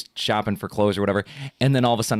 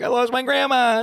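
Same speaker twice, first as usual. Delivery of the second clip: put-on much higher little-boy voice; lost little kid; 13 semitones higher, 3 dB louder than usual. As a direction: tell the cashier like a lost boy. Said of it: "I was like".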